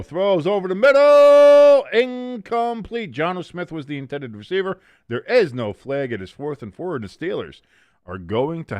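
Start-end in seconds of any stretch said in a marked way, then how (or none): 0.0-4.8 high, loud, slow commentator call; play-by-play call. Acted. Streamer narrating his game, broadcaster call. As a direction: call the pass like a TV announcer.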